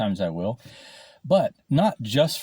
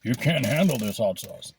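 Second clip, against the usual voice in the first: mock taunt; 3 semitones higher than usual. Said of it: "Handle it".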